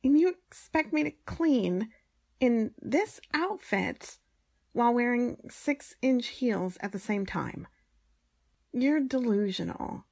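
loud, high outraged protest; indignant. Acted. Indignant protest about cleaning in heels.

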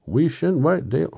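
very high, loud Irish protest; Irish accent. Acted. Reader retrying the Scarecrow's protest, high Irish accent. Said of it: "He was Irish all of a sudden".

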